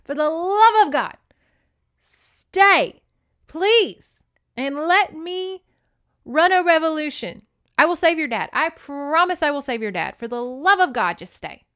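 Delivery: high, loud mock-pleading begging; exasperated pleading. Chance begging Desiree to stay put, mock-exasperated. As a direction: beg her in hammed-up frustration. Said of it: "he's just like".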